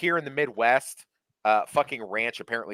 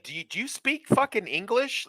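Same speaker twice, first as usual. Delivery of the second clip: exasperated retort; 13 semitones higher than usual. Retold or imitated. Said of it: "I'd be like".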